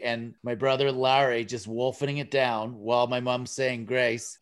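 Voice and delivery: normal talking voice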